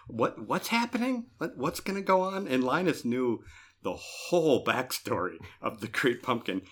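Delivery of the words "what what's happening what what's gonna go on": put-on high, puzzled little-girl questions; lightly voiced confused Sally; a hint of a bewildered kid; imitating Sally. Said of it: "she's like".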